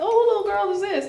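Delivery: high, fast surprised question; sudden curious high voice. Retold or imitated. Retold surprise at meeting sister.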